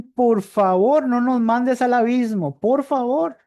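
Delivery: high pleading demon voice; demons begging. Retold or imitated. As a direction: beg for mercy like a terrified crowd. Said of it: "le suplican".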